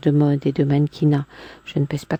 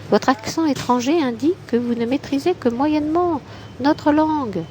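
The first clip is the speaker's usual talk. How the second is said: woman's voice; 10 semitones higher than usual. Acted. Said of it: "je ne sais pas qui parle".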